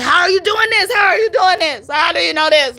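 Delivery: high, shouted, near crying; frantic shouted quote. Retold or imitated. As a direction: shout it like a client losing it. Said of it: "she said those words".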